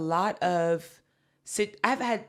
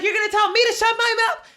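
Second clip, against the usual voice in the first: much higher and louder, shouting; two customers ganging up on the clerk, yelling; angry yelling; 9 dB louder, faster than usual. Retold or imitated. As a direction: yell it at the clerk together. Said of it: "She's like".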